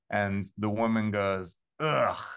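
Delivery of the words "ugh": a short groan of distaste; disgusted ugh. Retold or imitated. Snooty woman scoffing at the writer's picture, disgusted ugh. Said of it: "like that".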